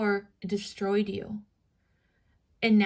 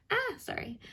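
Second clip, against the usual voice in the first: ordinary delivery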